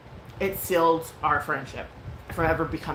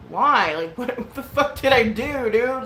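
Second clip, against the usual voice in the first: loud, higher incredulous protest; indignant bafflement; 6 semitones higher, 5 dB louder, slower than usual. Retold or imitated. Speaker answering the streamer's scolding, retold indignant disbelief. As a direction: protest as if wrongly accused.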